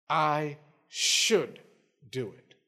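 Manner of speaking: SHOULD drawn out, DO IT dropped low; stress on SHOULD. Acted. Preacher quoting God's commitment to act, stress on SHOULD. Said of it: "there is an emphasis on that word".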